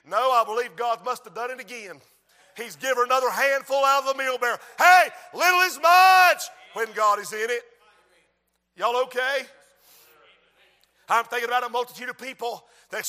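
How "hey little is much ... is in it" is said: loud ringing refrain; preaching shout. Real, not acted. Preacher shouting the refrain to the church. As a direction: drive the refrain home.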